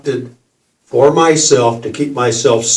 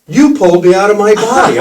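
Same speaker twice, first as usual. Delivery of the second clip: high, fast and louder, laughing; laughing disbelief; 7 semitones higher, 5 dB louder, faster than usual. Retold or imitated. Accusing the kid who pulled him out, laughing disbelief. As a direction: blurt it out in amused shock.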